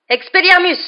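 high, very loud shouted incantation; shouted spell. A narrator's reading. Professor disarming the children with a spell, shouted. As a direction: cry out a disarming spell at full force. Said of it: "cria Lupin".

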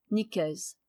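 ordinary delivery

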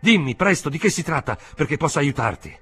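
high, loud, agitated scolding; too loud. A narrator's reading. Uncle demanding the truth to help his nephew, too loud. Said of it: "tu parli a voce troppo alta".

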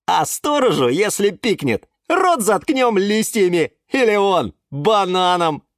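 high-pitched bold threat; scheming plant. A narrator's reading. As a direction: plot eagerly against the guard. План расправы со сторожем. (высокий задиристый голос)

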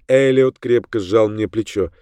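plain reading voice

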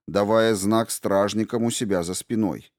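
usual speaking voice

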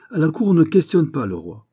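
high, loud royal rebuke; controlled anger. A narrator's reading. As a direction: lay down the rule like an offended king. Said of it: "dit-il d'une voix concentrée". (réprimande royale, haute et forte)

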